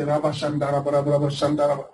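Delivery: soft, rhythmic tongues syllables "Rabba Shanda"; praying in tongues. Shown as a demonstration. Preacher demonstrating tongues to congregation, soft rhythmic murmur. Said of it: "Pray like that".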